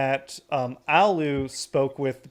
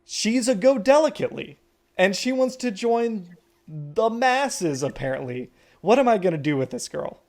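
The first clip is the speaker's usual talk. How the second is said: mock exasperated mom; 6 semitones higher, 3 dB louder than usual.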